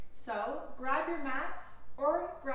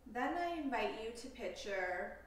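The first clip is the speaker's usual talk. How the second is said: slow, calm meditation voice with long pauses; guided meditation; 3 semitones lower, 4 dB quieter than usual. Acted. Yoga teacher leading listeners through a compassion meditation, calm guided delivery. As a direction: guide them unhurriedly into stillness, leaving space between phrases.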